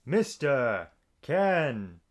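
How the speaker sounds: slow, loud showman name drop; grand announcer intro. Pretend. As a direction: announce it like a game show host. Grand host self-introduction.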